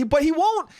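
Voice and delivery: ordinary talk, nothing marked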